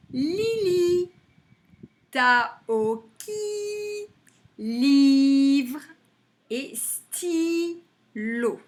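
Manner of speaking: each word chanted slowly with a long i: Lily, Taoki, Livre, stylo; slow, drawn-out word list; repeated with stretched syllables. Shown as a demonstration. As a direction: hold the i in every word. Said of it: "alors je répète".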